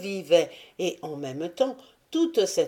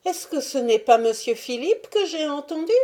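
higher, louder frightened question; startled and fearful, hands raised; alarmed old servant; 9 semitones higher, 4 dB louder than usual. Acted. Old Salomé hearing the master shout, alarmed and higher. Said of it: "toute blanche et les mains levées".

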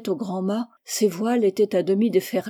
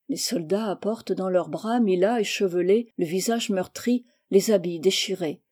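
usual speaking voice

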